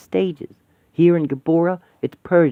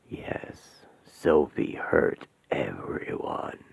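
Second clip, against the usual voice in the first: very low, slow, hissing whisper; demonic whisper; 12 semitones lower, 7 dB quieter, much slower than usual. Acted. Demon whispering to the women that Sophie hurt everyone. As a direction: breathe the accusation like a demon.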